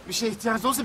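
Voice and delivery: usual speaking voice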